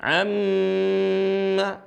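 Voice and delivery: "Amma" with the mm held long; long doubled m. Shown as a demonstration. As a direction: hum on the m of "Amma". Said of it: "Iya dibaca panjang". ("Amma" dengan mim didengungkan panjang)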